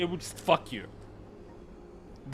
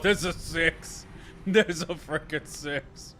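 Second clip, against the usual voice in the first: stunned slow; much slower than usual. Real, not acted. Stunned repetition.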